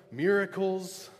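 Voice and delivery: ordinary delivery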